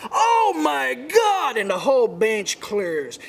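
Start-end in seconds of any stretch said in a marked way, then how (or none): 0.1-1.6 drawn-out, high, loud cry of disbelief; astonished yell. Retold or imitated. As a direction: gasp it out slowly like the manager just saw a miracle. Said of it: "I hear him go".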